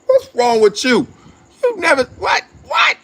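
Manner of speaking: shouting in rising disbelief, pitch jumping way up; mock outraged agent. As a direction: yell it like you can't believe what he did. Agent scolding player in mock scene.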